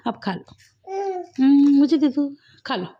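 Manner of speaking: ordinary talk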